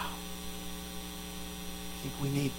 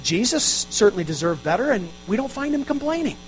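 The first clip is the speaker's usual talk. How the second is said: normal talking voice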